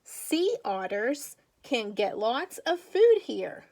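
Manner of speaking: put-on slow, clear read-aloud for young kids; reading to children. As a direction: read it slowly, like a picture book for little ones. Teacher reads picture book aloud.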